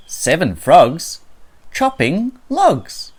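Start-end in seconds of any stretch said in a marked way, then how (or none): none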